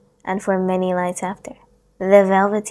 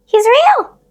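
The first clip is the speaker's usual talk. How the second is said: put-on much higher, loud little boy; the boy's voice; 11 dB louder than usual. A narrator's reading. Boy protesting to his nurse, high and loud. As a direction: protest like an upset small child. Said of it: "the boy said".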